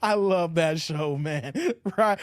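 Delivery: ordinary delivery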